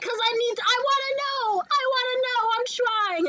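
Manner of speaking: high-pitched, overplayed insistence; eager pleading. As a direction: plead to be let in, hammed up. Acting out her eager plea.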